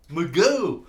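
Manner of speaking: real shout-out to a friend; calling out. Real, not acted. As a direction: holler the name as a greeting. Hails a viewer by name.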